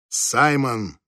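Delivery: firm call of the name, falling at the end; firm, commanding. A narrator's reading. Wife calls Simon in the dark bedroom, firm and commanding. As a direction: call the name as a restrained order. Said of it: "Голос её прозвучал так твёрдо и повелительно".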